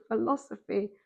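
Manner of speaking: ordinary delivery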